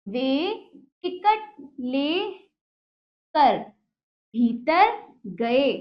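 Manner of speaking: slow, syllable by syllable reading; slow dictation reading; slow word by word. Shown as a demonstration. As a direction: read it out piece by piece for children. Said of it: "teacher reads slowly, word by word".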